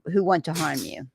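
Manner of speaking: ordinary talk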